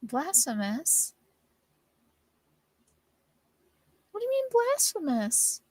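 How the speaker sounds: offended disbelief, pitch leaping up; incredulous. Acted. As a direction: bristle in disbelief at the accusation. Character indignantly questions the accusation.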